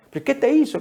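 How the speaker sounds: high, pressing demand; incredulous question. Acted. Preacher voicing Pharisees demanding an answer, incredulous. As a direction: ask in disbelief, pushing him. Pharisees interrogating the healed man.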